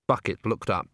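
plain reading voice

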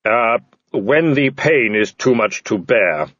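slow, even intoning; solemn Death. Acted. Death answering the man's question, slow solemn intoning. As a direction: intone it gravely, drawn out.

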